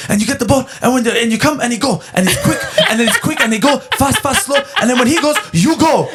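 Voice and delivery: high, hammed-up coach rattling off instructions; mimicking Pep Guardiola's team talk; mock broken-English coach. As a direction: parody a foreign coach yelling tactics, laughing through it. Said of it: "this guy's just yelling kind of broken English".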